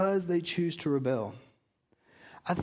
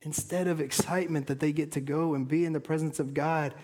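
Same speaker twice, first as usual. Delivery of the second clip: ordinary talk, nothing marked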